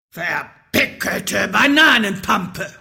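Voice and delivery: angry shout. Acted. Wizard in a stinking rage, angry shout. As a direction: throw it out as an angry swearword. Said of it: "schrie er".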